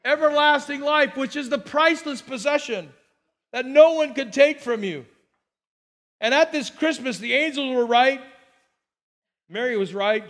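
loud, driving pulpit delivery; preaching at full force. Acted. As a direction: proclaim it to the whole church. Preacher building to a climax.

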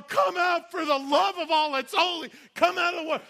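high, loud desperate calling; frantic yell. Retold or imitated. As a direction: yell at him to come out. Crowd yelling from the bank.

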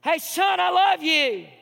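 father yelling. Retold or imitated. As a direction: yell it down the street to the son. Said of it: "walks to the end of his driveway yelling".

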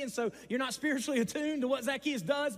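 ordinary talk, nothing marked

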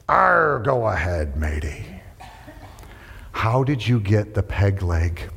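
lightly voiced gruff pirate. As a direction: growl it like an old sea dog. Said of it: "The pirate said".

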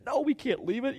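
high-pitched imitation of a protest; protesting helper. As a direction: mimic an insistent objection, voice jumping way up. Voices others refusing to leave gear.

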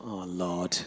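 very low, hushed groan of dismay; retold private prayer under the breath; dismayed inner prayer. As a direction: say it softly, as a thought. Retold alarmed thought to himself.

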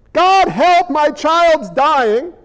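"God, help" cried out high and loud, then slow; a frantic cry to God, dragged out slowly; mock desperate plea. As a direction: plead like a parent in a crisis. Acted desperate prayer.

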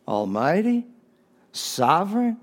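ordinary delivery

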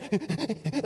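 high, stuttering mock sobs; demonstrated ugly sob in short pulses; gut-shaking sob. Shown as a demonstration. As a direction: imitate heaving, gut-shaking crying. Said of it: "like that, that one".

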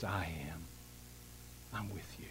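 very low, soft, near-whispered comfort; quiet, intimate divine promise; hushed reassurance. Acted. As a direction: drop to a hush to reassure a sufferer. God's reassurance to the sufferer.

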